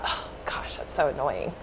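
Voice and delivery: breathy whisper of irritation; whispered annoyed thought. Retold or imitated. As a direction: mutter it under your breath, annoyed. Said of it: "And immediately I thought".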